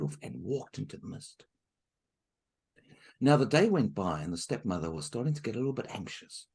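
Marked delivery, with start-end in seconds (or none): none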